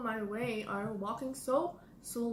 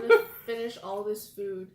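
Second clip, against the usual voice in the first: laughing; 9 dB louder than usual. Real, not acted. Laughing while declaring to finish.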